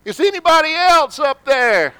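high, loud comic call upward; desperate yell. Retold or imitated. Man on the ledge yells for someone else, high and loud. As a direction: shout up, hoping for anyone else. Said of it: "he says".

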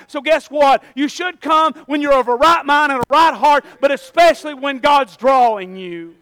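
loud, driving pulpit preaching; preaching at full force. Acted. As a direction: hammer it home to the congregation. Preaching to the congregation.

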